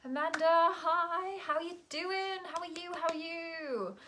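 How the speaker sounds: high, sing-song hello to a friend; excited greeting. Real, not acted. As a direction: greet them in a high, delighted lilt. Greeting a viewer joining live.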